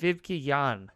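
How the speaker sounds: VIVKE JAN with V, and JAN as "yahn"; German-style pronunciation. Shown as a demonstration. Host correcting co-host on a German name, VIVKE JAN with V and "yahn". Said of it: "is what I would say".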